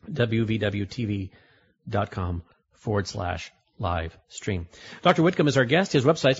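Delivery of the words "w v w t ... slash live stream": slow dictation. Real, not acted. Host repeats the web address for listeners, slow dictation. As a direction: spell it out slowly, piece by piece.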